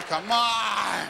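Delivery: loud, drawn-out exasperated cry; impatient shout. Acted. Impatient guy urging himself to hurry up, exasperated shout. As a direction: yell it and stretch the last word. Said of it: "You just stand there going".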